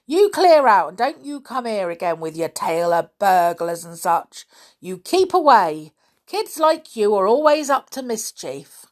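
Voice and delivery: put-on loud, cross old caretaker; angry scolding. A narrator's reading. Caretaker ordering pestering kids to clear out, angry. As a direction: tell them off loudly.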